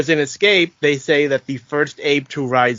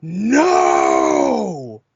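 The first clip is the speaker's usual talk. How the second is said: long, drawn-out defiant shout; Andy Serkis's ape shout. Retold or imitated. First ape to rise up and speak, defiant shout. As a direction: bellow it as one long roared word. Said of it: "hear Andy Serkis just stand up upright".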